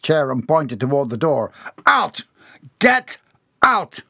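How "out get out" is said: high, loud single-word blasts; furious shout. A narrator's reading. Boss pointing employee to the door, shouting. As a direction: fire off each word with a gap.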